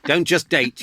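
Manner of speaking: normal talking voice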